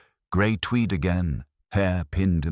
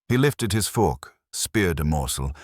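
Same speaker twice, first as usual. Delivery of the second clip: usual speaking voice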